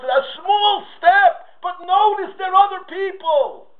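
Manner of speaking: high, shouted sermon climax; fiery preaching. Acted. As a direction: preach it out with full fervor. Preaches at full force.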